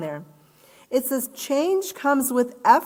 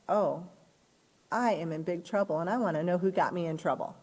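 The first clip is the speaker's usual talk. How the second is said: mock dejected; 4 semitones lower, 7 dB quieter than usual. Worried employee reaction.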